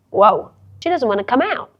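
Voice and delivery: usual speaking voice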